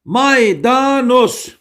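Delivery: Maydanoz stretched out vowel by vowel; teacher drilling Maydanoz in long, slow syllables; syllable-by-syllable mnemonic. Shown as a demonstration. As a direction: draw out each syllable of Maydanoz slowly. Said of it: "A, A, O kuralı".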